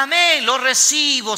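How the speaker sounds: high, drawn-out mock church cry; imitated congregation shouting. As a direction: mimic eager believers calling out, slowly. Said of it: "gritan aforadamente". (grito imitado de fieles entusiastas)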